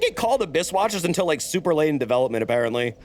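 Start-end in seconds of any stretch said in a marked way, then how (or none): none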